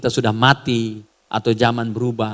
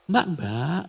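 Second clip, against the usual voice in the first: softer, gentle polite call; soft Javanese call; 6 dB quieter than usual. Shown as a demonstration. Javanese person calls a woman gently, softer and polite. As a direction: murmur mbak kindly. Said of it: "ngomongnya pelan".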